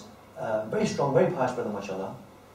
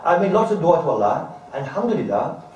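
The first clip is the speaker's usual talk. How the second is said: ordinary delivery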